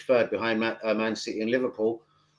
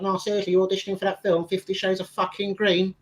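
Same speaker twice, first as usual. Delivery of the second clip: higher-pitched mock female questioner; imitated woman; 7 semitones higher than usual. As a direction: imitate her insisting, pitched well up. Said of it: "She was like".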